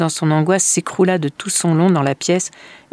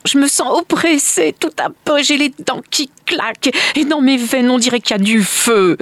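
high-pitched, louder cry of oppression and dread; panicked mother; 9 semitones higher, 4 dB louder than usual. Acted. Anguished mother tells the family she feels oppressed, panicked. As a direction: gasp it out high, as if burning inside.

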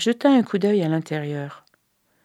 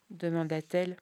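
ordinary delivery

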